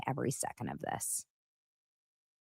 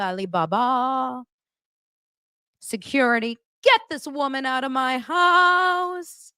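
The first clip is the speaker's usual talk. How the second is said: higher, slow, grandstanding put-on voice; a hammed-up speech about women then a shout for security; Meredith impression; 6 semitones higher than usual. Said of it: "She says".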